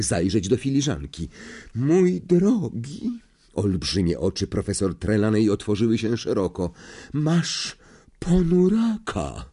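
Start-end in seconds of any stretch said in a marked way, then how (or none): 1.7-3.2 slow, drawn-out fortune-teller voice; prophesy it, lingering on each word; a seer's ominous reading; mystic seer. A narrator's reading. Trelawney eyes wide. 7.1-9.5 high, slow, trembling seer voice; theatrical seer wailing over the boy; anguished seer. A narrator's reading. Trelawney's verdict.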